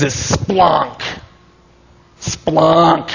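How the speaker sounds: "splonk" slow, stretched like a sound effect; comic pronunciation. Shown as a demonstration. Preacher enjoying the funny Greek word, comic drawn-out delivery. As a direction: relish a long, drawn-out "splonk". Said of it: "it's Greek and it's funny".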